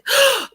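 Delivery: sudden high gasp of realization; sharp gasp. Real, not acted. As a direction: gasp as it hits you. Sudden thought mid-story.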